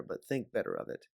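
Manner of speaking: plain reading voice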